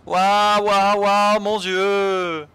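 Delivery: high, loud drawn-out waouh; excited wow. Acted. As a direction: gasp in amazement. Reading a character's excited lines. (émerveillement aigu et fort)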